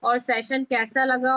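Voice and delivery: ordinary talk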